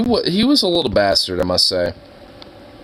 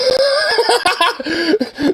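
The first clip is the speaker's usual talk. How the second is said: high laugh. Real, not acted. [name] grooming himself, laughing.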